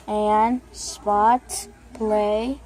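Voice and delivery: ordinary talk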